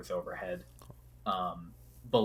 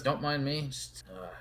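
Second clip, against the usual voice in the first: Kuromizawa's voice; 6 semitones higher than usual. Acted. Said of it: "yep, yep, he says".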